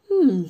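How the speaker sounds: sharp doubtful hmm; suspicious hum. Acted. Ollivander eyes Hagrid, suspicious hum. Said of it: "marmonna monsieur Ollivander".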